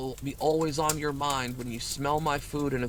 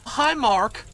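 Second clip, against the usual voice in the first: Tommy Wiseau greeting; 7 semitones higher, 6 dB louder than usual. Retold or imitated. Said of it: "I instantly went".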